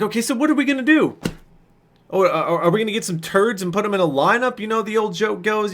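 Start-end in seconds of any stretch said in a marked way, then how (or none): 0.0-4.6 high, fast sarcastic jab; mock the whole idea; high, quick sarcastic comeback; snarky. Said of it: "didn't really appreciate my snarkiness".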